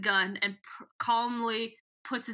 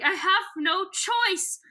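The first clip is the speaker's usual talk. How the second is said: high, slow hammed-up lament; mock despair; 9 semitones higher, 4 dB louder, slower than usual. Voicing Edris caught in the trap, mock tragic. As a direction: draw it out like a tragic heroine. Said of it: "Edris is like".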